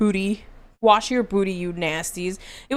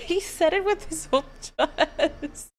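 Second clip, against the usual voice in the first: laughing; 7 semitones higher, 4 dB quieter than usual. Real, not acted.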